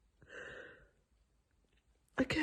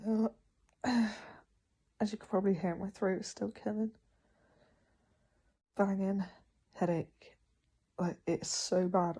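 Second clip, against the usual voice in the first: normal talking voice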